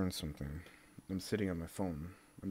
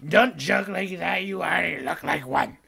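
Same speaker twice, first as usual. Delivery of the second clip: louder, slow, higher reprimand; mock scolding; 7 semitones higher, 10 dB louder than usual. The internet telling him off, a loud slow reprimand.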